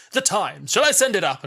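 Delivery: lightly put-on Strax-style call, lifted in pitch; comic character quote. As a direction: call it out like an eager butler. Acting out Strax's line from the scene.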